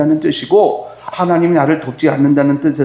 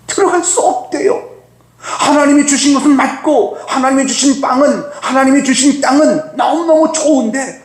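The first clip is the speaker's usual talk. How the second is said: pitched-up, sarcastic outrage; incredulous exasperation; 10 semitones higher than usual. Real, not acted. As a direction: throw their excuse back in disbelief. Exasperated retelling of the spies' excuse.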